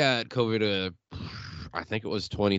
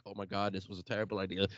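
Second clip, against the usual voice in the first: muttered regret; 4 semitones lower, 9 dB quieter, faster than usual. Retold or imitated. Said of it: "I'm like".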